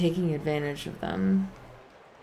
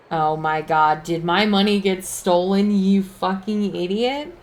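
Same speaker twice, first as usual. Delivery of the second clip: louder, outraged at the companion; mock furious yell; 3 semitones higher, 6 dB louder than usual. Real, not acted. Player scolding companion over stolen money, loud and outraged. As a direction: tear into him for losing it.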